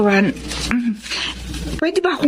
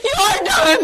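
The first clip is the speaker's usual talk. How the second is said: sobbing, much higher wail of denial; anguished crying; 14 semitones higher, 4 dB quieter, slower than usual. Acted. Wife waking without her legs, crying out to husband and brother. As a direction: cry out in despair. Wakes to find legs amputated.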